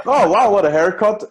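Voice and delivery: sarcastic, high and loud "wow"; fake-gush over the haircut; mock praise. Mock compliment on friend's haircut.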